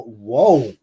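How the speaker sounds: normal talking voice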